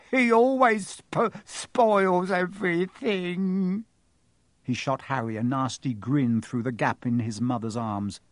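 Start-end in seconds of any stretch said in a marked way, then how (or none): 0.1-3.9 fake sobbing yell. A narrator's reading. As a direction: wail it like a spoiled brat faking tears. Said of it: "huge pretend sobs".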